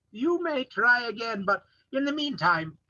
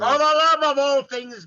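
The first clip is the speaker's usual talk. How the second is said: exasperated shout; 6 semitones higher, 8 dB louder than usual. Real, not acted. Director exasperated, cutting actor off.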